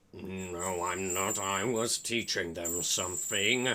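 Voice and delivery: low, sullen denial; Snape denying. Acted. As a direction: deny it low and sulky. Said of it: "Snape clammed".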